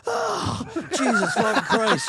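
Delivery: very high, loud cry of pain breaking into a laugh; pained yell. Retold or imitated. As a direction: yelp as the nail hits the metal. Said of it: "I went".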